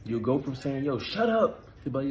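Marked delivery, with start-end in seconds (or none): 0.9-1.6 curt, higher "Yo, shut up"; a dismissive brush-off, pitched up; rude snap. Shown as a demonstration. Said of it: "You see the difference?".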